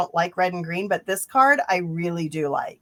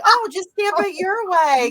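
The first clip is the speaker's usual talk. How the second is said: high, louder sing-out of a self-mocking quote; breezy mock excuse; 11 semitones higher, 3 dB louder than usual. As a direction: toss it off brightly, imitating your own cop-out. Mimicking their own breezy excuse.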